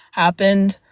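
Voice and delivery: ordinary talk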